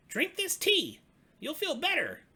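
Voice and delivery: very high, loud cub ordering; bossy little cub. Acted. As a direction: insist like a tiny stern nurse. Little Cub commands Old Bear.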